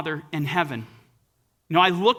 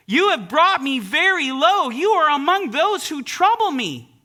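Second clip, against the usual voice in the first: anguished lament; 6 semitones higher, 4 dB louder than usual. Acted. Preacher voicing Jephthah seeing his daughter, anguished and raised. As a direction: cry out as a broken father.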